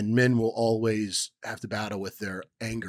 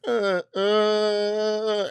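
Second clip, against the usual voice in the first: imitating a baby; 11 semitones higher than usual. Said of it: "My daughter was like".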